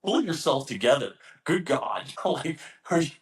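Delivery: retold exasperated outburst; mock exasperation. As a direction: tell a crybaby to get a grip. Retold exasperation at crying trainee.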